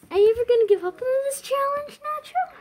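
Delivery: high cooing pet voice. Real, not acted. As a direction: coo at the cat. Teasing the cat, pet voice.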